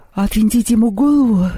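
high-pitched words stretched through a yawn. A narrator's reading. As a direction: yawn right through the question. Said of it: "зевнул Мишка".

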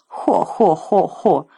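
slow jeering ho-ho belly laugh; mocking dragon guffaw. A narrator's reading. Dragoness guffawing at Jim's boldness. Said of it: "издевательским смехом".

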